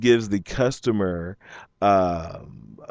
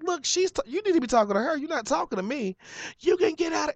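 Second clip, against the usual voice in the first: high, pushed-up indignant voice; heated retort; 3 dB quieter, faster than usual. Retold or imitated. Arguing back at the yelling worker, high and indignant. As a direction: argue back way up in the voice.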